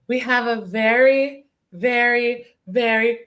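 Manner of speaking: each very stretched slowly; slow, savoring repeats of very; drawn-out emphasis. Real, not acted. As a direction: linger on every very for build-up. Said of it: "very special guest".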